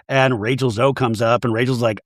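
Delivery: ordinary delivery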